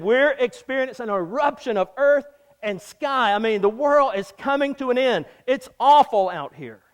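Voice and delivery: loud, high panicked cry over the storm; panicked disciples. Retold or imitated. Disciples crying out to each other in the storm, loud and panicked. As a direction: shout it like men about to drown.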